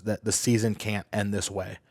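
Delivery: normal talking voice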